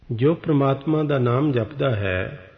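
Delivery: ordinary talk, nothing marked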